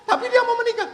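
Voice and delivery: high-pitched laughing wife voice; high, half-laughing complaint to the priest; complaining wife. Acted. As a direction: squeal it out like an upset wife telling on him.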